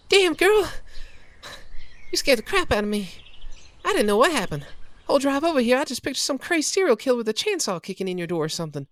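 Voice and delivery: best friend venting her panic after racing over; Kathy relieved and shaken. Acted. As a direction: vent the fright, then settle.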